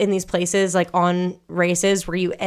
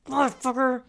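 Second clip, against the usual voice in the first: muttered curse; 5 semitones higher, 6 dB quieter than usual. Retold or imitated. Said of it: "I was pissed".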